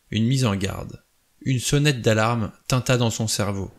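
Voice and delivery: usual speaking voice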